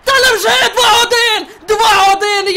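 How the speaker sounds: high, loud ecstatic screaming; screaming like a maniac. Real, not acted. Gamer screaming as the winning goal goes in. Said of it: "що я кричу як псих".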